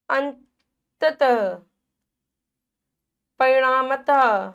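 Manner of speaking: अंततः and परिणामतः read slowly with the final ह; stretched visarga. Shown as a demonstration. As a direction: drag each word to its ह ending. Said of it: "हा की ध्वनि प्रकट करता है".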